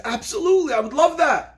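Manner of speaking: high-pitched, delighted retold yes; overjoyed acceptance. Orphan girl accepting rabbi's offer to adopt her, overjoyed. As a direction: burst out with an eager yes. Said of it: "girl is overcome with emotion".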